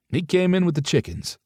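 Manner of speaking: high, wry grumble about early rising; wry complaint. A narrator's reading. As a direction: complain with a pulled face. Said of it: "Beckett made a face".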